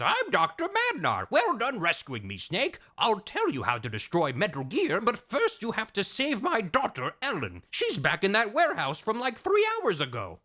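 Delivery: high, eccentric scientist voice; hammed-up rescued scientist; Doctor Madnar. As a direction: play a daffy high-pitched professor. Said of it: "He goes".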